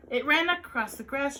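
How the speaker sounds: plain reading voice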